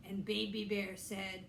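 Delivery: plain reading voice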